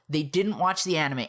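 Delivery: usual speaking voice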